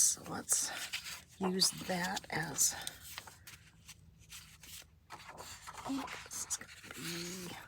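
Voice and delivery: breathy whisper while crafting. Real, not acted.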